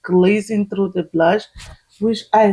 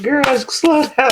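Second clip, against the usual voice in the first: mock viewer voice; 4 semitones higher, slower than usual. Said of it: "Y'all like".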